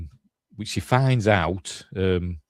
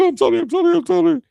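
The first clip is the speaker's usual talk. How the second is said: very high, loud squealed apology; squeaky mock apology; 6 dB louder, much faster than usual. Killer apologising to his victim, hammed-up high squeal. Acts Golic apologising after killing.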